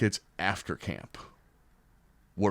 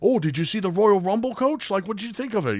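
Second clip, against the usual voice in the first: very high excited question; eager player; 11 semitones higher, 5 dB louder than usual. Retold or imitated. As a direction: chat up the coach like a fan kid. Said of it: "the guys will ask me".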